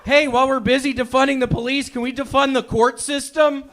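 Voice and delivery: escalating divorce rant; bitter rant. Acted. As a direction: rant at the judge, building to a yell. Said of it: "and I was thinking".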